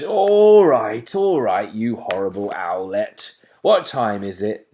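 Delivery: loud, weary giving in; a father owl dragged awake; loud grumpy dad owl; grumbling father owl. A narrator's reading.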